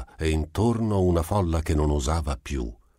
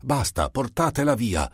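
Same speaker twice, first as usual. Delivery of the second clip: higher, firm stern command; commanding order; 8 semitones higher than usual. Acted. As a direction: order them to take her away. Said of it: "intimò la patronessa".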